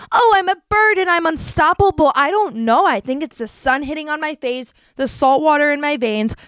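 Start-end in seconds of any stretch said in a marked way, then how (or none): 0.1-2.1 higher, louder, soaring with excitement; giddy and free. Pretend. Telling listeners how travel makes her feel, giddy and high. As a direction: fling it out like you could fly.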